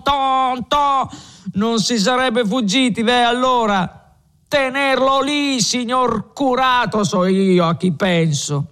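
put-on a drunk lecturing the tavern, pleased with himself; drunk Renzo.

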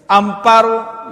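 slow, loud, high call; shouting. Acted. Husband shouting at his wife from a metre away. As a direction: bellow the name slowly. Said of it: "y le grita".

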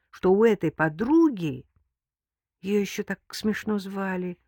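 usual speaking voice

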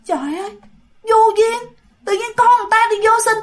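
high-pitched, exasperated rebuke; scolding little man. Acted. Dwarf spirit tells off the ghost woman, exasperated. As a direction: start hushed, then scold high and fast. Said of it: "thì cái ông đó nói".

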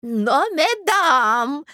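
high, drawn-out protest; old prince crying out. A narrator's reading. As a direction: cry out to quarrelling ladies as an old prince. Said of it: "закричал князь". (старый князь протяжно вскрикивает)

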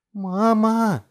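very high, loud cry; piercing little girl's yell; child's shout. A narrator's reading. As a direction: scream for mother. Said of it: "крикнула Делия".

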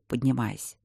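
plain reading voice

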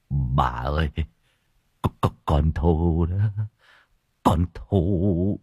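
choked, slow and low; old man barely getting the words out through tears; tearful whisper. A narrator's reading. Husband tells wife their daughter Thu is hurt, tearful whisper. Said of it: "nước mắt lưng tròng".

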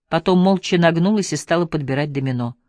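usual speaking voice

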